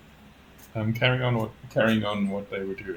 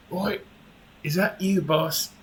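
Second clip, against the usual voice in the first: a lightly voiced guard, a touch higher; guard calling out; 7 semitones higher than usual. A guard peeks through the door, lightly voiced call. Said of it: "you hear a voice going".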